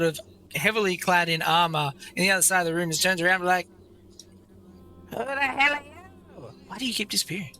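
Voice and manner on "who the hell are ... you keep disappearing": high, rough undead guard voice; a startled skeleton demanding answers. Acted.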